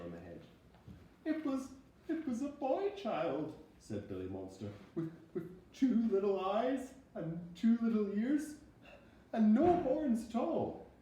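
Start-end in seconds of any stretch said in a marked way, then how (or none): 1.2-3.5 high frightened little voice; a stammering little monster; small scared monster. Acted. Reading to a child as Billy Monster, high, a touch louder. 4.9-10.9 high frightened little voice; a stammering little monster; small scared monster. Acted. Reading to a child as Billy Monster, high, a touch louder.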